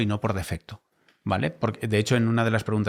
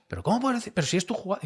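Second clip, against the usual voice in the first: much higher, mock disbelieving colleague; incredulous colleague; 11 semitones higher, faster than usual. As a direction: imitate a colleague who can't believe it. Retold colleague's disbelief in argument.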